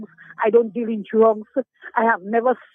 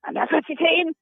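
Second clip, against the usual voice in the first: mimicking the boss; 4 semitones higher than usual. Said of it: "and he said".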